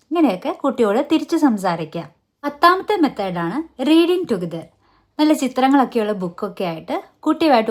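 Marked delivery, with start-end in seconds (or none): none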